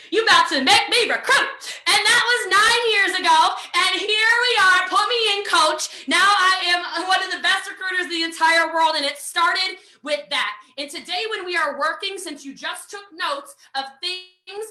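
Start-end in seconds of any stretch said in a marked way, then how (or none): 0.1-8.9 loud, proud brag; triumphant. Real, not acted. Boasting to her team about her success, loud and proud. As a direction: shout it like a champion.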